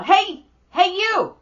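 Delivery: put-on high, loud kid holler; imitating demanding little boy. Nephew wanting his aunt to fly him, high demanding holler. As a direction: demand attention like a small child.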